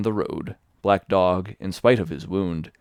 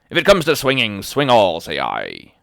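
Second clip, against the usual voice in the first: furious loud refusal; loud cry; 2 semitones higher, 9 dB louder than usual. A narrator's reading. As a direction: shout the refusal through the wall. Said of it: "he cried".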